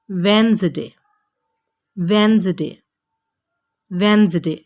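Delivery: वेंसडे as WENZ-day; वेंसडे repeated clearly; correct pronunciation. Shown as a demonstration. Teacher drilling Wednesday. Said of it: "आपने कहना है".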